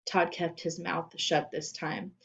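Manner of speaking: usual speaking voice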